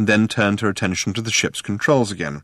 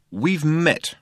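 pitched-up, curt interjection; sharp interruption; 6 semitones higher than usual. A narrator's reading. Arthur cuts into Ford's introduction, sharply. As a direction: cut in sharply without raising the volume. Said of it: "said Arthur sharply".

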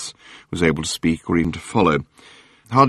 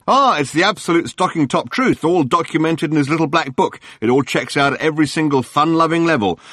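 gushing tabloid hack; 7 semitones higher than usual. Acted. Journalist Murray greeting Arthur on the phone, gushing. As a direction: lay the flattery on thick. Murray voiced, high and chatty.

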